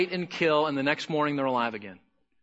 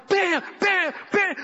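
high, punchy "bam" hits; shovel bam sounds; 13 semitones higher, slower than usual. Retold or imitated. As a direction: smack each bam like a shovel blow. Shovel strikes on snake.

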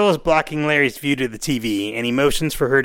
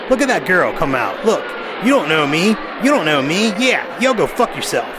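higher-pitched, animated talking back at the TV; animated heckling; 4 semitones higher than usual. Retold or imitated. Host imitating Larry yelling at the Maury show, animated. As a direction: imitate a man getting worked up at a talk show. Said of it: "Larry's getting quite animated".

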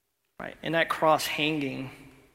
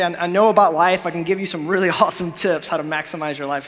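louder, quick hyped-up life coach with a laugh; mock life coach; 3 semitones higher, 9 dB louder, faster than usual. Preacher mocking a life-coach Jesus for the congregation. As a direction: ham up a salesy self-help guru.

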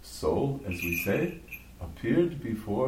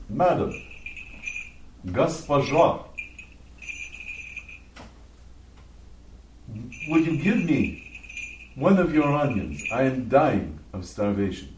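pleading beggar. Acted. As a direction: plead earnestly for a scrap of food. Starving beggar pleads for onion.

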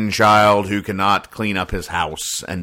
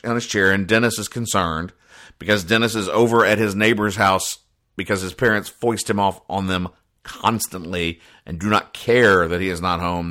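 ordinary talk, nothing marked

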